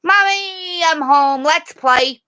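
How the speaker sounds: high, loud excited dog voice; voicing the big dog. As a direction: bound in like a happy pup. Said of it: "that big dog coming in going".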